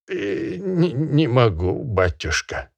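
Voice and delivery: high, stammering peasant voice with a nervous laugh; fawning peasant. Acted. Peasant fawning to the barin, high and stammering. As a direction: grovel and stammer before the master. Khromoy answering meekly.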